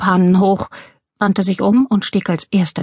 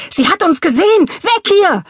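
much higher, frantic yelling; panicked shout; 12 semitones higher, 4 dB louder than usual. A narrator's reading. Burglar shouting to his partner to flee, panicked. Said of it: "schrie Ray".

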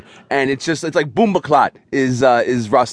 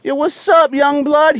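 dad's jive impression; 11 semitones higher, 3 dB louder, slower than usual. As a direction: play the embarrassing dad trying to sound cool.

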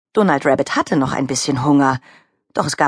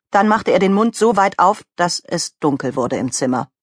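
ordinary delivery

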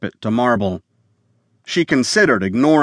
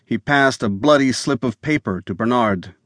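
plain reading voice

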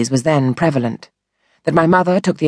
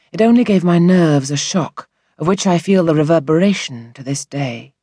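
ordinary delivery

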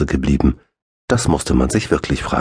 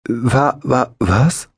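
frightened stammer; 8 semitones higher, much slower than usual. A narrator's reading. Eddie erschrickt, stottert.

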